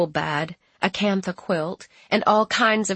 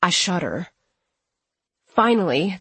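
plain reading voice

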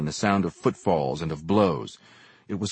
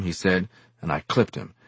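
usual speaking voice

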